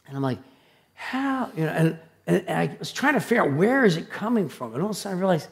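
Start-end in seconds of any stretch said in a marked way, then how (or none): 0.9-1.5 high, hushed faraway cry; imitated distant scream. Preacher imitating screams from the bay, soft high cry. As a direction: imitate a faint cry from across water. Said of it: "I just heard this screaming".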